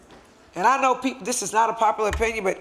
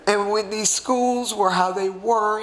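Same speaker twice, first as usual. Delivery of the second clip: emotional; slower than usual. Real, not acted. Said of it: "I'm sorry that I'm emotional".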